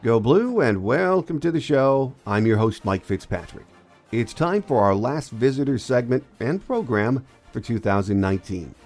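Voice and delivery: ordinary delivery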